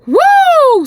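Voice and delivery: high, loud whoop of excitement; hyped-up woo after preaching; excited woo. Real, not acted. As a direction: let out a joyful whoop. Hyped shout after sermon.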